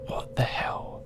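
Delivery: hushed, voiceless whisper; whisper to oneself. A narrator's reading. Guard muttering to himself at the silent radio, whispered. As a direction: whisper it under your breath. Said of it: "I can remember whispering to myself".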